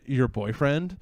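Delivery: ordinary talk, nothing marked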